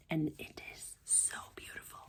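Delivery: hushed, admiring the fabric; awed whisper. Real, not acted. Crafter showing fabric to viewers, whispering.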